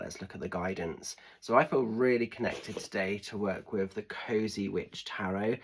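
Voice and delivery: usual speaking voice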